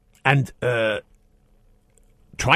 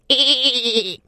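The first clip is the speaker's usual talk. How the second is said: a hint lower, still high ee; 10 semitones higher than usual. Shown as a demonstration. Host shows the caller the better noise, a bit lower. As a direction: hum a slightly lower ee. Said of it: "more like that".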